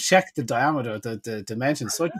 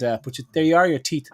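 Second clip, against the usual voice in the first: usual speaking voice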